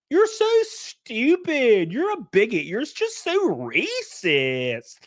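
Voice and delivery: slow, high-swooping mock Valley Girl; Hasan Piker Valley Girl impression. Imitating the first debate clip he saw, Valley Girl accent.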